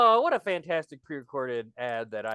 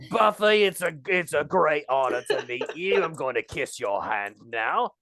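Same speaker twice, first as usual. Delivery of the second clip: hammed-up fake Cockney. Guest greeting Buffy with a hand kiss, mock Cockney. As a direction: gallant guest in a put-on Cockney accent. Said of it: "Cockney accent".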